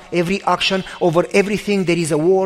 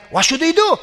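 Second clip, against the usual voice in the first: higher-pitched anxious question; mildly panicked, voice pitched up; worried friend, retold; 8 semitones higher than usual. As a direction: act out a cornered friend asking for a plan. Friend's worried question while trapped.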